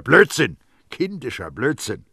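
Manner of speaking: loud burst, then contemptuous; exasperated outburst at oneself; angry self-scolding. A narrator's reading. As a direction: dismiss your own silliness sharply. Baldini angrily scolds himself.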